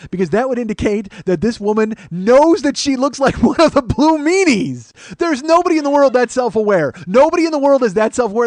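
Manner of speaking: building to high, loud disbelief; incredulous rant. Real, not acted. Comic to audience about waitress's self-awareness, incredulous shouting. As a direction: rant at full voice, astonished.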